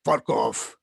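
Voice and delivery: low, curt mock Russian; a bad Russian accent, snapped out. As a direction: chairman dismissing the doctor in a deep mock Russian accent. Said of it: "let's all do it in as close to a Russian accent".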